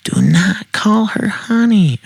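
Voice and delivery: high, slow screech without extra volume; near scream. A narrator's reading. Jealous woman repeating herself to the man, near screaming. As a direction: screech it slowly, high-pitched but held back. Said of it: "practically screams".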